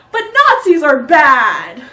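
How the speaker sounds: loud, high, drawn-out yell; exasperated shout. Real, not acted. Comedian sums up the Nazi argument, shouting in exasperation. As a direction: shout it slowly, every word stretched.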